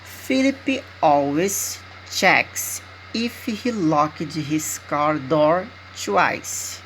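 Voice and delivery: slow, careful model sentence for repeating; deliberate teacher dictation pace. Shown as a demonstration. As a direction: read it out slowly so students can repeat.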